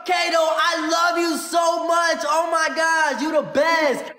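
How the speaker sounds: hype shout. Real, not acted. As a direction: shout your thanks to the gifter. Hyping a gifter on stream.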